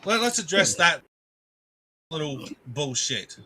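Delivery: ordinary delivery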